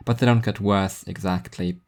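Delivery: ordinary delivery, nothing marked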